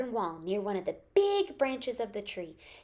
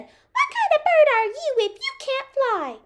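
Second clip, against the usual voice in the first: very high, loud bird voice; teasing little bird; 8 dB louder than usual. A narrator's reading. Bird mocks the swimming duck, high and teasing. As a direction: taunt in a squeaky high bird voice. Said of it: "The bird saw the duck swimming and flew over and said".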